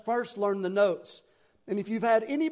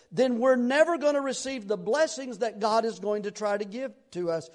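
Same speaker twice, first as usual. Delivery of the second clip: normal talking voice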